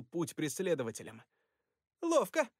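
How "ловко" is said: high gleeful grin; smirking. Acted. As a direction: cheer it out with a smirk. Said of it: "ухмыльнулась Мэг".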